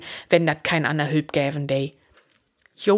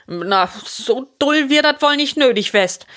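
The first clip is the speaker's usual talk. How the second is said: higher, emphatic objection; 8 semitones higher, 4 dB louder than usual. A narrator's reading. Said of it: "säh Jochen mit Nadruck". (mit Nadruck)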